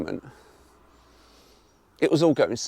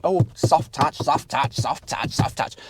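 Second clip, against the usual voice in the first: mock reviewer cliché; slower than usual. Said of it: "I know I'm doing the typical, stereotypical thing".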